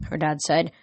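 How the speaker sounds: plain reading voice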